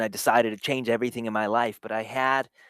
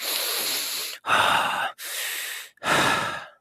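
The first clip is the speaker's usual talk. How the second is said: heavy sigh. Retold or imitated. Anxious driver struggling for breath.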